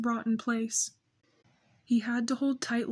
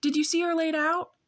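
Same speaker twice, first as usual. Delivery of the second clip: put-on high, eager little girl voice; bursting to know; excited; 6 semitones higher, 3 dB louder than usual. Little sister asks her brother, excited and high. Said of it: "she asked excitedly".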